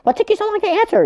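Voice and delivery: very high mock falsetto demand; hammed-up high-pitched nagging; impatient caller. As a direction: squeal the complaint down the phone.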